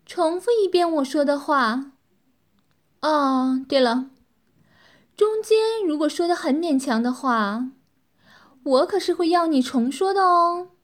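high, loud, toying woman's voice; mischievous teasing. A narrator's reading. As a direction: toy with him playfully while giving orders. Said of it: "恶作剧般的捉弄天祥".

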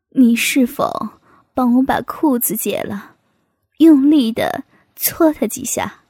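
low-pitched man's character voice; male character making a request. Acted. Man asks sister-in-law for help, in a low character voice.

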